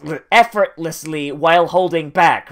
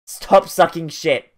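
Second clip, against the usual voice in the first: normal talking voice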